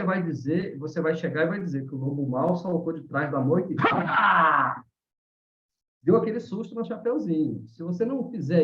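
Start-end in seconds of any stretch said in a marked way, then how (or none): 3.8-4.8 sudden loud "HA!" scare cry; startling shout. Shown as a demonstration. Storyteller jumping out as the Big Bad Wolf, sudden startling shout. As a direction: burst out a big scare yell. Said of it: "exagerei aqui no meu grito".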